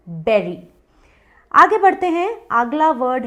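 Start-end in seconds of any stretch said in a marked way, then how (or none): 0.1-0.6 बेरी like the end of gooseberry; short e in बेरी, "ber-ee"; correct pronunciation. Shown as a demonstration. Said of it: "वैसे ही". (बेरी को गूसबेरी के "बेरी" जैसा बोलें)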